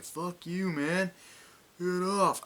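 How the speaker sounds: high, loud retold yell; mock angry shout. A fight during the argument, yelled in mock anger. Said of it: "He's like".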